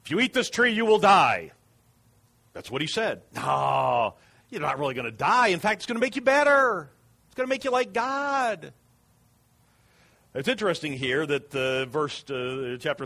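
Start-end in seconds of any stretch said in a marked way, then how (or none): 0.0-1.5 high and loud, a commanding decree; stern warning. Acted. God warning the woman, high and loud. Restating God's warning forcefully. 3.3-8.7 smooth sell rising high and loud; tempting serpent. Acted. Serpent luring the woman, rising high and loud.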